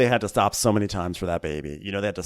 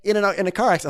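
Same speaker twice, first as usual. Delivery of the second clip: high, louder, bluntly cheerful host; blunt mock Andy; 10 semitones higher, 5 dB louder than usual. Imitating Andy blurting out the mother-in-law's death, high and loud. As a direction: blurt it out breezily. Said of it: "He's like".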